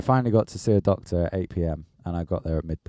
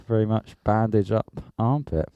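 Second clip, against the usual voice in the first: ordinary talk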